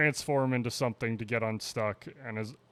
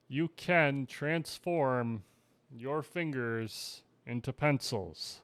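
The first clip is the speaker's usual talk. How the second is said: deliberate, slow announcement; slower than usual. Real, not acted. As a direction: drag it out for effect. Slow announcement of bad joke.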